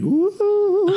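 high, loud ghostly woo; ghostly wail. Pretend. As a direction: let out a spooky ghost woo. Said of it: "I make a ghost sound as I do it".

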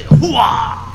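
Al Pacino hooah. Retold or imitated. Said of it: "Is that where Al Pacino gets it?".

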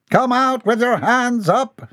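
high, slow, theatrical order; brave demand. A narrator's reading. Captain orders the stranger out, brave high demand. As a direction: pitch it up and draw out the command. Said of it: "he demanded bravely".

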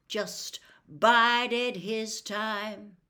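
drawn-out, very slow repeat; very slow echo. Acted. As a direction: drag it out to close the case. Tate repeats, even slower.

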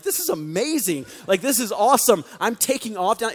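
high, loud gushing thrill; giddy like a kid in a new car; excited. Retold or imitated. Retold thrill driving Charger.

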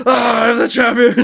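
over-the-top villain shout; aggressive champion shout. Retold or imitated. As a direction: bellow it like a hammy brute. Mocking aggressive Alexios.

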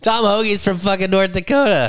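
high-pitched laughing disbelief; incredulous outburst. Real, not acted. Co-host learning where the golfer is from, incredulous outburst. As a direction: protest it high, cracking up. Mock outrage amid laughter.

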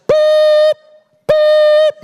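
loud high-pitched reversing beeps; dump truck beeping. Acted. Preacher imitating a dump truck at Christmas dinner, piercing beeps. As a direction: blare it like a truck backing up. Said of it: "of a dump truck".

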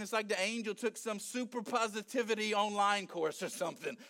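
usual speaking voice

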